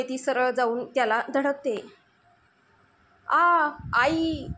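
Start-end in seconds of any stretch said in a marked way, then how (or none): 3.3-4.5 drawn-out pained cry, slow; very slow, anguished cry for her mother. A narrator's reading. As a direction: wail it out in pain. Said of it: "Mayuri कळवळून ओरडते".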